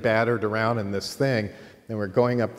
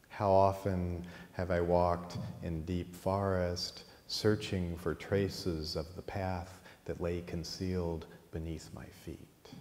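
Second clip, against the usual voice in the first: unhurried recited verse, dropping softer at the close; slow poetry reading; 2 semitones lower, 6 dB quieter, slower than usual. Retold or imitated. Reciting his own poem to a friend, slow and reverent. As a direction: lay the lines out slowly like a poem. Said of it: "spontaneous poetry readings".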